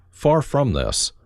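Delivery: ordinary delivery, nothing marked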